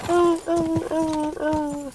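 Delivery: high whimpering dog noises. Retold or imitated. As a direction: whine like a scared dog. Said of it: "They go".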